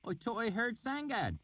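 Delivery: high rustic voice; thick valley accent. A narrator's reading. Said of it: "his accent so thick".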